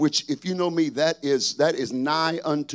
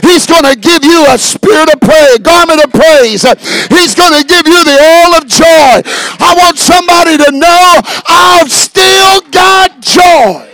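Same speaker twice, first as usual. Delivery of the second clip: high, loud shouted preaching; full-force preaching; 11 semitones higher, 18 dB louder than usual. Acted. Preacher shouting joy to the congregation, full force. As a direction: preach it out to the whole church.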